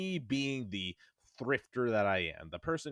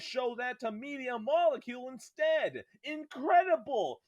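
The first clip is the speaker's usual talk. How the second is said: much higher, mock-pleading sarcasm building to a squeal; sarcastic rant; 8 semitones higher than usual. Real, not acted. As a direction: sneer at the boss with exaggerated high sarcasm. Sarcastic rant about Japan Studio.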